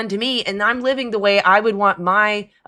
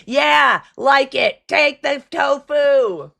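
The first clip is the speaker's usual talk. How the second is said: mad scientist; 7 semitones higher, 3 dB louder, much slower than usual. Pretend. Villain keeps forcing tofu.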